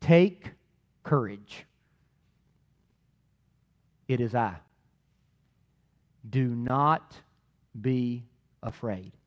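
quiet, slow and tender; Jesus calming them, soft and slow; tender reassurance. Acted. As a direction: soothe frightened disciples gently. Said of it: "church, hear this".

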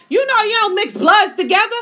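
worked-up, loud outburst; loud rant. Real, not acted. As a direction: get loud and worked up, stretching the last words. Heated rant about Alabama relatives.

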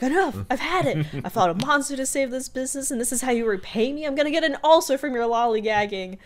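loud, high scolding of the staff; manager mode. Retold or imitated. Mabel in manager mode yelling at her lazy coworkers, loud and high. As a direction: bark orders like a furious boss. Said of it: "has to go into manager mode".

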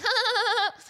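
high canned sitcom laugh; mock laugh track, high; fake studio laughter. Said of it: "Fake studio laughter".

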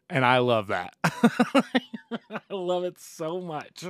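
slow words through laughter; laughing hard while talking. Real, not acted. Laughing at the headline.

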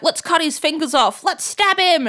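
high, hammed-up villain glee; rising, overeager torturer voice; mock eager villains. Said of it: "And they're like".

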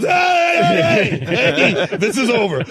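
high, loud shouts of hey; retold shout. Shouting at the brawling crowd to stop the fight.

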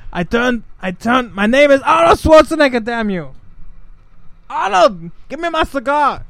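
high, loud, flamboyant action star; hammed-up Austrian accent gone flamboyant; Arnold Schwarzenegger. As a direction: camp up the famous actor impersonation. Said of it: "Arnold turned flamboyant".

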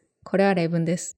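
casual rapid-fire Japanese; fast, natural speech. Shown as a demonstration. Contrasting real conversation with test audio, fast and natural.

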